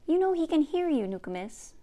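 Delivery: put-on a bright-eyed girl poking fun at her grandma; young Gidgee.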